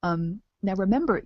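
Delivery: usual speaking voice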